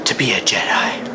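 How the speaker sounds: whispered reverence; dramatic whisper. Acted. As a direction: let it out in awe. Dramatic realization.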